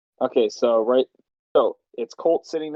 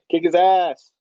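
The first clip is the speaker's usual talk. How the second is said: calmer cheer; 6 semitones higher, 6 dB louder, slower than usual. Shown as a demonstration. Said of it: "sound a little calmer".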